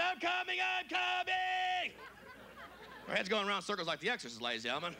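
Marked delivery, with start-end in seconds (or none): 0.0-1.9 high, stretched-out climactic scream. Acted. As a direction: scream it, holding the last word long. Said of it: "She's screaming".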